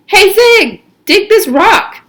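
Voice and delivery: loud, high call to a friend across the dig; excited call. A narrator's reading. Magnet calls to Zigzag about the rock, loud and excited. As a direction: shout it over, thrilled with the find. Magnet shows the fossil around.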